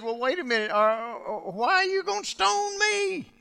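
high, mock-puzzled sarcastic questions; sarcastic Jesus. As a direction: needle them with fake innocence. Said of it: "I love the sarcasm of Jesus".

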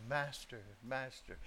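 soft, timid mock call; mock the polite preacher version, all hushed; mock meek plea. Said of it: "I love some preachers, bless their tender little hearts".

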